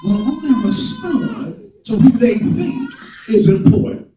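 hammering sermon refrain; full-force preaching. Acted. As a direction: drive it home to the congregation. Preaching rises again.

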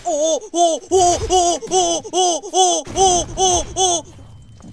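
loud, high string of "oh"; rhythmic hooting. Real, not acted. Player refusing to take it, frantic high hooting. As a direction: hoot over and over in quick rhythm.

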